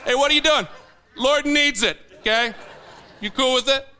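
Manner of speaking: a little comic shout-out; mock confrontation. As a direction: ham up a joking Mercedes grab. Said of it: "Just be like".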